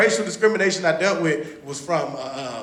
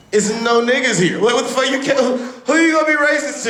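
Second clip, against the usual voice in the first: high-pitched exasperated disbelief; worked-up comic incredulity; incredulous; 7 semitones higher than usual. Retold or imitated. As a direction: demand it in rising, baffled outrage. Acted disbelief in retold trip.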